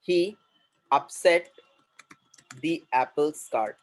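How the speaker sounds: word by word with long pauses; slow dictation. Shown as a demonstration. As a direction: dictate it slowly to a class. Teacher dictates a new English phrase. (धीरे-धीरे, रुक-रुक कर)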